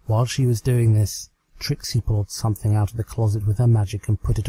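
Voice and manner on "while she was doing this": ordinary delivery, nothing marked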